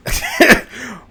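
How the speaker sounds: a sudden high burst of laughter; cackling at the thought of Rachel finding out; high cackle. Real, not acted. Laugh after a jab at Rachel.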